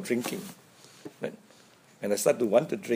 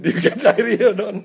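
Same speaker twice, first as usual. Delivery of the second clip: teasing laugh; 9 semitones higher, 7 dB louder than usual. Retold or imitated. Teasing his friend about the prank.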